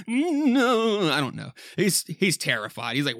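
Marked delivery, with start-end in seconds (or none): 0.1-1.1 high, loud cry of no; anguished cry. Acted. Husband hearing the doctor, crying no. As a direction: cry out in despair. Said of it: "Is that better?".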